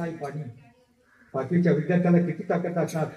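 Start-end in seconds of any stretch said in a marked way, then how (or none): 0.0-0.4 much softer, parched and fading; an exhausted child asking for water; faint thirsty plea. Retold or imitated. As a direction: barely get the words out, near collapse. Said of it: "डोळे पांढरे केले".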